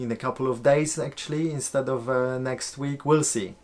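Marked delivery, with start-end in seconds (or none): none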